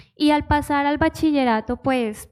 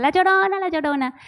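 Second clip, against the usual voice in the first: mock schoolyard taunt; 6 semitones higher than usual. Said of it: "Y me decían".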